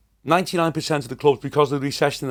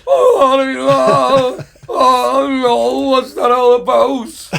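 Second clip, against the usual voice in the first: high, loud, near-crying wail, slowing at the end; mock sobbing of a terrified hard man; frightened whimper; 11 semitones higher, 9 dB louder, much slower than usual. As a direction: whimper it like you're about to cry. Scared friend whimpering after moth.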